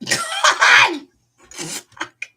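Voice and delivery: high, pained laughter; laughing in pain. Real, not acted. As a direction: laugh through the sting. Cat jumps off her back.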